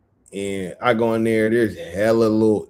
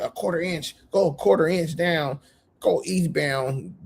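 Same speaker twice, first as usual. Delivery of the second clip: higher, fussy know-it-all instructions; mocking coworker's instructions; 7 semitones higher than usual. Mocking the coworker's measuring instructions in a higher know-it-all voice. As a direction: imitate him giving precise directions. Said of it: "I don't know what eastbound".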